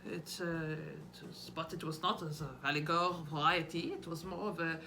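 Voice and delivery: low Swiss psychiatrist with upspeak; hesitant, accented, curious lilt rising at phrase ends; Carl Jung. Acted. Mimicking a Swiss psychiatrist in interviews, curious upspeak. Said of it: "what Carl Jung sounds like".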